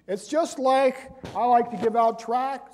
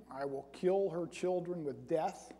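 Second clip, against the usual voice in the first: softer, musing repeat of the verse; quiet requote; 2 semitones lower, 7 dB quieter than usual. Shown as a demonstration. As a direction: say it again softly, as if puzzling over it. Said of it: "Isn't that kind of redundant?".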